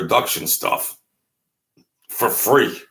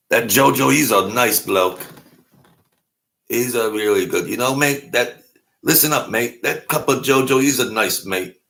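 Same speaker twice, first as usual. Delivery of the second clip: hammed-up amateur English accent; clumsy mock-British praise; bad English accent; 3 semitones higher than usual. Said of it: "Bad accent, English accent".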